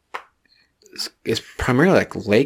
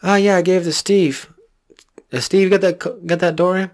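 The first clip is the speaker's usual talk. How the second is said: higher, dopey voice passing the blame; mock dumb cop; 9 semitones higher than usual. Cop blaming Steve for the lost door ram, higher and dopey. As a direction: play the clueless cop covering himself. Acted cops blaming each other.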